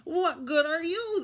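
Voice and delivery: high, loud parrot mimicry; parrot echo. A narrator's reading. Parrot mimicking the grumpy pirate, echoing high and loud. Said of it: "the parrot asks back".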